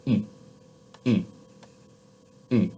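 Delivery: e said with lips pushed inward, tongue unchanged; rounded French u. Shown as a demonstration. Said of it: "it's just my lips that have moved".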